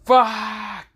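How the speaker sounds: high, drawn-out yell of frustration; loud scream. Retold or imitated. Napper who doesn't want to go, screaming out loud. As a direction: yell the word out long and high. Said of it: "Issei basically screams out loud".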